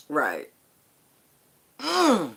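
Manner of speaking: ordinary talk, nothing marked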